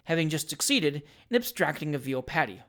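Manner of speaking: usual speaking voice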